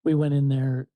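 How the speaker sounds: ordinary delivery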